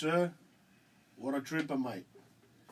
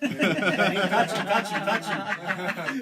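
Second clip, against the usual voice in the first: laughing; 6 semitones higher, 9 dB louder, much slower than usual. Real, not acted. Joking about mate being there.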